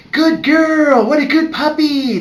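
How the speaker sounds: high, loud sing-song puppy praise; baby talk. Shown as a demonstration. Vet praising a puppy to perk her ears up, excited baby talk. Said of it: "baby talk is voice tone number one".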